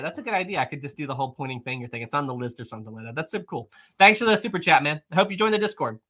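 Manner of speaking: ordinary talk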